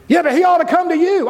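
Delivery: high, indignant retort; lightly voiced petty objection; mock objection. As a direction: whine back in protest. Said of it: "objector's voice".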